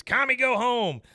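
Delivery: loud, high, slow retold shout; mock yell. Dale shouting at Luann to go home, loud mock yell. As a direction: bark it out like an angry neighbor.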